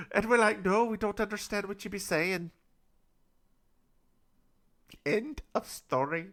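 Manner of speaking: baffled, hammed-up Scottish; put-on Scottish accent. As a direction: settle back down in comic Scottish brogue. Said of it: "with just this accent I have right now".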